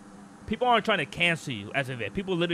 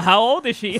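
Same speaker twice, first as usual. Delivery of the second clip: mocking question; 6 semitones higher, 9 dB louder than usual. Mocking imagined listeners, laughs right after.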